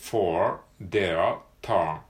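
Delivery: "for their turn" with a strong American r on each vowel, very slow; r-colored vowels. Shown as a demonstration. Teacher models "for their turn" slowly, with a rolled American r. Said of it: "Rが出てくることが多いですね".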